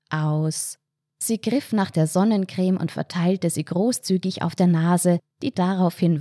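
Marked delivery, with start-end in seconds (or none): 0.1-0.8 drawn out and level, slightly lowered; meditative Atemansage. Acted. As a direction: release it slowly, like a breathing cue. Entspannte Atemübung im Kopf angesagt. (gedehnt und ruhig, wie beim Ausatmen)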